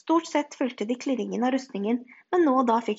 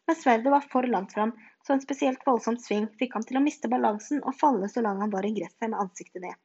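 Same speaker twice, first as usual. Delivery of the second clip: usual speaking voice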